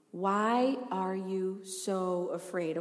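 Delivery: slow, soft lead-in line; "Why are you so afraid?" said slowly and quietly, as a first prompt. Shown as a demonstration. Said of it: "Say it with me".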